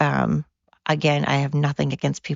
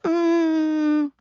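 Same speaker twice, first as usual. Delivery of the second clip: high, sing-song brush-off, slowed on the weapons question; sweetly decline, then shrug it off; lilting fake-friendly voice saying no; mock polite refusal; 9 semitones higher, slower than usual. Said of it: "We should probably be like".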